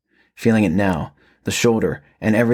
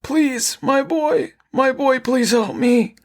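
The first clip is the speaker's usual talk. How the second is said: much higher, strained pleading of a monster mimicking a father; raspy pleading cry; slower than usual. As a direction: cry for help like a creature wearing a dead man's voice. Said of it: "the raspy voice cried".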